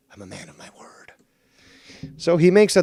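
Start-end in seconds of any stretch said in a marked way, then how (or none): 0.1-1.1 breathy whispered aside. Real, not acted. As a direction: drop to a whisper. Aside while bringing caller in.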